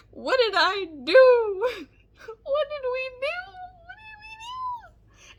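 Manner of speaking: high, slow fake sob; mock sobbing. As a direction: wail it like a fake cry. Mock crying lament.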